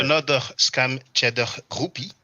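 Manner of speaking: mock French accent with a throaty French R. Reading out a viewer's comment on the live, French accent. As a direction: ham up the French accent just for fun. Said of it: "I was about to speak like this with a French accent".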